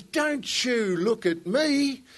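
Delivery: Australian widow rounds savagely on the other two widows; savage retort. Acted. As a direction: spit it at them like a hammed-up fierce widow. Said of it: "She turns savagely to them".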